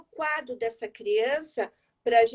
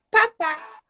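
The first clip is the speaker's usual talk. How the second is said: put-on higher toddler babbling papá; a small child saying papá, higher; child's first word; 6 semitones higher, 5 dB louder than usual. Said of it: "Aí a criança começa".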